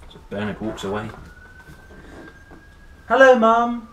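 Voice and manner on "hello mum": higher, louder cheerful greeting; Bernard's voice. A narrator's reading. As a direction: sing out hello to Mum like a small boy. Bernard greets his mum.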